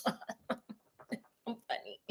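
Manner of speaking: very slow, whispered self-praise; smug whisper. Retold or imitated. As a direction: breathe it out pleased with himself. Holmes smugly praising himself.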